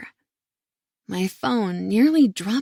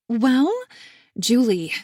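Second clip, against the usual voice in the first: louder, sharp warning to her sister; harsh tone; 3 semitones higher, 5 dB louder than usual. A narrator's reading. Emma warning her sister Julie in a harsh tone. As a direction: cut her off harshly, a touch raised. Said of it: "I say with a harsh tone".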